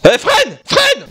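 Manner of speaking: high, loud mock panic; urgent high-pitched cry; panicked passenger. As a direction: yell it like a crash is coming. Passenger yelling to brake before crash.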